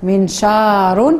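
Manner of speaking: منشار stretched slowly, syllable by syllable; slow model reading. Shown as a demonstration. Teacher modelling a word for the class, very slowly. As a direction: draw out منشار slowly for the pupils. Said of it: "وتنقراو بالفصحى منشار".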